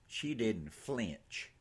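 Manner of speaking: plain reading voice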